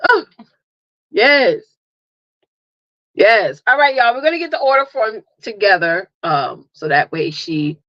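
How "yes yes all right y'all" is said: usual speaking voice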